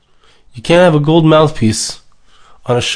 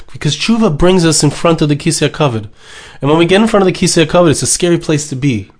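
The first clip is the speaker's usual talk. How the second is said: normal talking voice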